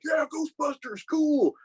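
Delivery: high, strained mock cheer; cheering fans. Drunk people downtown greeting a Ghostbuster, high mock-excited cheer. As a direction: imitate excited revellers hollering without real volume.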